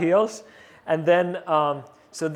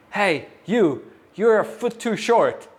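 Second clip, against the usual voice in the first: imitating a yell, higher; mock shout at the stand-in; a hammed-up crew yell across the set; retold yell; 5 semitones higher than usual. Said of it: "he yells, he yells at me".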